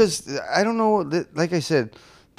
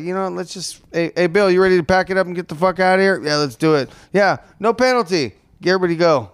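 raised, loud mock referee chatter; imitating the referees; 5 semitones higher, 5 dB louder than usual. Football fan mocking referees eager to leave, loud hurried calling. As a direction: call out briskly to a fellow official, hurrying off. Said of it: "the referee's like".

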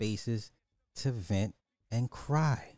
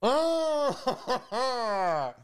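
laughing; 9 semitones higher, slower than usual. Real, not acted. Laughing retelling the clip.

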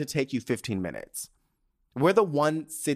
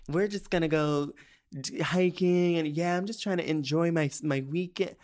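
lightly mocking laid-back voice, higher and easy; mock chill people; 5 semitones higher than usual. Woman mocks laid-back locals with their weekend plans, airy mock-chill voice. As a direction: imitate a chilled-out Californian, pitched up. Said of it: "everyone's so fucking chill".

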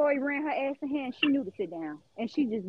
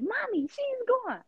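imitating her son; 9 semitones higher, slower than usual. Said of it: "He come back like".

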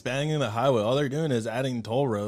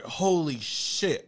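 slow stunned; 2 semitones higher, much slower than usual. Retold or imitated. Call drops, realizes crash.

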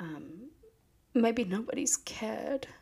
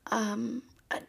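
normal talking voice

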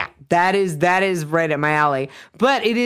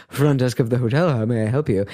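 low, smooth mock front-desk seduction; imitating a sultry, deep American receptionist; sexy American voice; 6 semitones lower, faster than usual. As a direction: drop your voice like a suave desk clerk. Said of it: "his sexy American voice".

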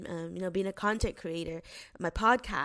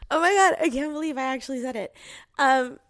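fast, louder excited rush; 4 semitones higher, 7 dB louder, faster than usual. Real, not acted. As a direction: gush it out in disbelief. Just announced leaving teaching.

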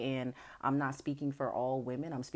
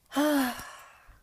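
big, loud exhale; audible sighing breath; 10 semitones higher, 6 dB louder than usual. Real, not acted. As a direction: let out a heavy sigh. Sigh after acting the partner.

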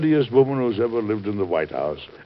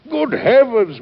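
much higher, louder startled outcry; shocked exclamation; 13 semitones higher, 5 dB louder, slower than usual. Acted. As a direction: burst out in scandalised surprise. Shocked at wife's claim.

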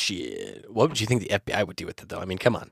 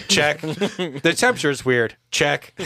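mock inspector; 5 semitones higher, 4 dB louder, slower than usual. Mock ghost inspector checklist.

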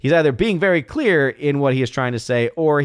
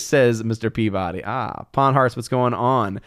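ordinary delivery

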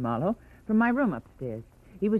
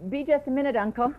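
usual speaking voice

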